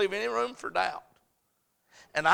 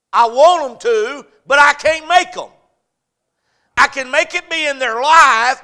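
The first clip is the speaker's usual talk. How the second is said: loud, high impassioned preaching; full-force preaching; 8 semitones higher, 14 dB louder than usual. Acted.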